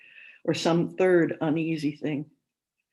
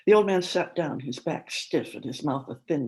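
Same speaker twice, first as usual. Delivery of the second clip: ordinary delivery, nothing marked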